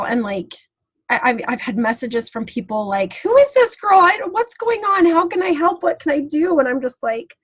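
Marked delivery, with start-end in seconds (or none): 3.2-6.6 high, pleading imitation of worried messagers; urgent concern. People messaging to offer help, urgent and high. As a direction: start high and loud, easing down through anxious questions.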